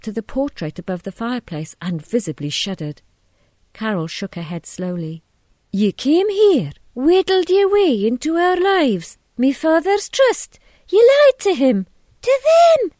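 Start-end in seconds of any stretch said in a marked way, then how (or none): 5.7-12.9 furious accusation climbing higher and louder; outraged wife, voice rising to a shriek; rising pitch. A narrator's reading. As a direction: start high and loud, climb with each charge. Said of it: "With each utterance her voice had risen in pitch".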